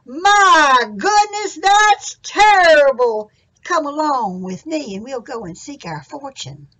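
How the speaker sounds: very slow, high, loud my goodness; Jack's dismay for the audience. Shown as a demonstration. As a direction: lead the children in the big exclamation. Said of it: "go just like Jack did and say".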